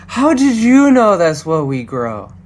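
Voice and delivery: lightly tipsy father; a touch of drunken wonder. Acted. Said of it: "a bit drunk".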